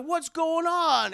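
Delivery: high, loud, slow question; stretched-out baffled exclamation; Detective Boppity Boop. Acted. Detective finds his partner, high drawn-out bafflement.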